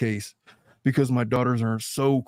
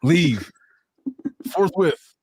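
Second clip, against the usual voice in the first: loud, curt mock order, rising higher on the second word; mock command; 4 semitones higher, 4 dB louder, much slower than usual. Host orders a sick friend out, mock stern. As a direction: banish them with playful pomp.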